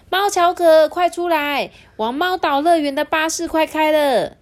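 high, loud eager calling out; bright eager shout at the door; excited call. Acted. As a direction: call your friend out, bursting with excitement. Xiaohua calls out excitedly at door.

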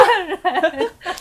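high giggling burst; burst of high laughter. Real, not acted. 讲妈妈骗她大笑. (高声爆笑)

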